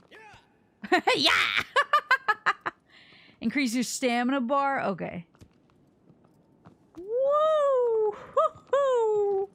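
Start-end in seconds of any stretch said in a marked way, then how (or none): 0.1-2.7 laughing. Real, not acted. Excited triumph in game. 7.0-9.5 high whooping; hollering. Real, not acted. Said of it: "whooping while playing".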